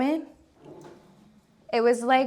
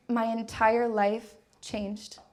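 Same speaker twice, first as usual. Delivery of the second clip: normal talking voice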